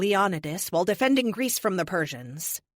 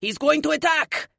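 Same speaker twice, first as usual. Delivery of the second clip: much higher, louder warning shout; cried out alarm; 10 semitones higher, 6 dB louder than usual. A narrator's reading. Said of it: "Moonwatch cried out".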